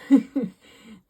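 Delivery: bursting out laughing; a sudden hearty laugh; loud laugh. Real, not acted. Laughing about bathrobe.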